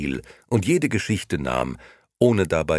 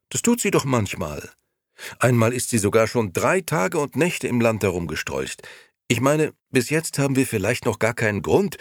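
higher, lightly voiced young man, unsure; young Gigi, hesitant; 6 semitones higher than usual. Gigi cautiously opening up to his old friend, higher voice. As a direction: ease into a worried thought.

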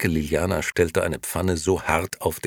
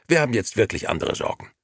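quick, higher, irritated; 6 semitones higher, 4 dB louder, faster than usual. A narrator's reading. Nino excuses himself to Momo, quick and irritated. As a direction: brush Momo off testily. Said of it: "sagte Nino gereizt".

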